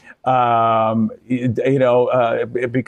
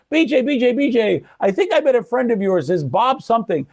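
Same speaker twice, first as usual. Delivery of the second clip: excited greeting; 9 semitones higher than usual. Retold or imitated. Ricky greets him, retold.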